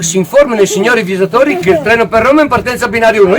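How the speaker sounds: fast hurried PA announcement; rushed announcement. Pretend. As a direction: rattle it off quickly.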